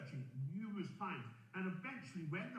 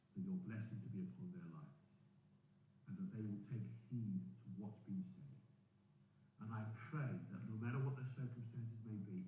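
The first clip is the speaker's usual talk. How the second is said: low, soft, earnest prayer; closing prayer; 7 semitones lower, 3 dB quieter than usual. Real, not acted. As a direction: pray quietly with pauses between phrases.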